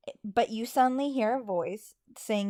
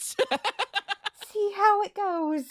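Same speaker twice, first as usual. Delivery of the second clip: laughing; 8 semitones higher, 5 dB louder, much slower than usual. Real, not acted. Joking shrug while partner laughs.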